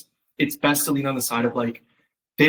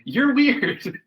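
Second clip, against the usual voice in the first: high laughing you're weird; laughing tease; 10 semitones higher, much slower than usual. Retold or imitated. Mom amused by her daughter's gibberish, high laughing tease.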